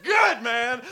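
mock ecstatic reply; over-the-top whoop. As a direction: burst into fake glee. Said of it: "And you were like".

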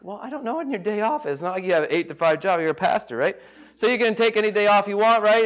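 high, quick teasing voice; mocking congregant. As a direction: needle the pastor about his easy schedule. Congregant mocking pastor's schedule.

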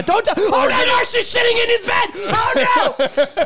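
high, loud panicked no no; alarmed shout. Real, not acted. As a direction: shout in real alarm at the mess. Watching a live pet mess.

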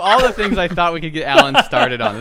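very loud and high, cracking up; high, laughing exasperation; laughing disbelief. Real, not acted. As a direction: shout it helplessly through laughter. Cracking up at Alan's unexpected tangent.

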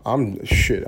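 normal talking voice

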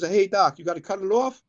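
hammed-up worried patient; anxious patient. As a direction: ask like you dread the answer. Anxious patient asks doctor.

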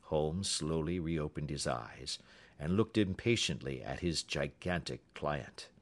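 plain reading voice

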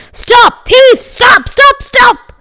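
high, loud yell. Acted. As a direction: yell after the thief. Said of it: "the baker ran out of his store yelling".

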